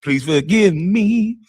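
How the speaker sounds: high, slow, whiny begging voice; mock pleading apology. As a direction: plead like a pitiful crybaby. Mocking a tearful apology.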